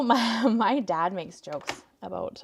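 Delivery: ordinary talk, nothing marked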